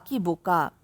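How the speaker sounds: a woman scolding her younger self, "কি বোকা" fading into a murmur; faded echo. A narrator's reading. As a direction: let the repeated "কি বোকা" die away under the breath. Narrator's self-reproach, repeated.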